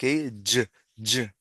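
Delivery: "y, y" as short "juh" sounds; two separate voiced "juh" bursts for "y"; English J sound. Shown as a demonstration. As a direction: isolate the J for each "y". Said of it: "suena así".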